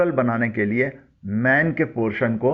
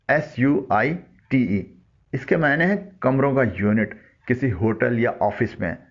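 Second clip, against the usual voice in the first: ordinary delivery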